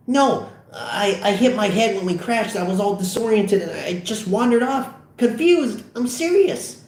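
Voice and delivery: nervous high-pitched babble; panicked excuse. Acted. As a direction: plead your innocence in a high voice. Fogel pleads excuses when caught.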